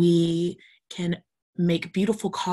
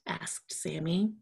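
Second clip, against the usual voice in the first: usual speaking voice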